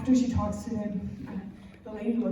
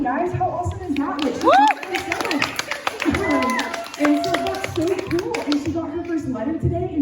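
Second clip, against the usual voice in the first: bubbly higher voice spilling over with delight; excited gush; 7 semitones higher than usual. Real, not acted. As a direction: rave about how cool it is. Enthused about Dylan's sponsorship.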